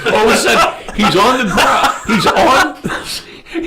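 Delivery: high, loud words through real laughter; helpless laughter breaking up the words; laughing while talking. Real, not acted. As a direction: try to tell it while cracking up. Laughing through his own story.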